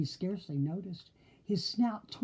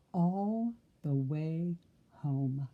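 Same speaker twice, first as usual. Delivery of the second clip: slow singsong; much slower than usual. Acted. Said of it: "the three little pigs rang".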